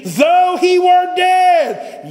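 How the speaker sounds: high, loud, slow shout of the verse; preaching shout. Acted. Preacher to congregation at the climax, slow loud shout. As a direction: cry it out at the peak of the sermon.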